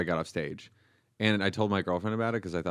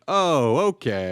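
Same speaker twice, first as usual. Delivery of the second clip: slow, loud, resigned "Oh, okay"; a long, defeated "Oh, okay"; drawn-out oh okay; 3 semitones higher, 8 dB louder, much slower than usual. Retold or imitated. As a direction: stretch it out, caught off guard and giving up. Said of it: "I just went".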